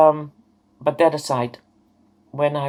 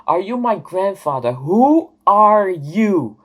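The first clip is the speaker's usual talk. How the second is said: retold angry yell; 4 semitones higher, 6 dB louder than usual. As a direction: relive yelling at a stranger, demanding answers. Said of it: "And I yelled at him".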